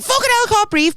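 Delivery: high, loud panicked swear, can't get air; panicked cry. Retold or imitated. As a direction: yelp it like you're suffocating in the mask. Panicking in snorkel mask.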